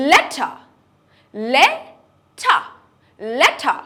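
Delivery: letter with a crisp T and no R; sharp British T. Shown as a demonstration. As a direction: clip the T sharply, British style. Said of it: "very, very sharply".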